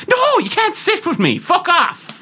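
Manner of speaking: shrill-high mock brush-off, laughing through it; mock snooty Irish woman. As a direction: squeak it out like a haughty girl blowing off a lad.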